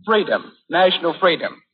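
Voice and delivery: ordinary talk, nothing marked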